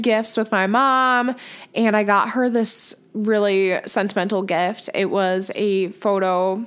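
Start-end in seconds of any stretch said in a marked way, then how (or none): none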